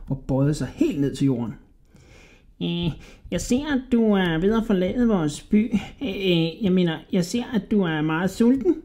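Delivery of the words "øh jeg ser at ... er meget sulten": high, squeaky, wheedling rat voice; sly little rat man. A narrator's reading. Little rat flattering a hungry Hodja, high and squeaky.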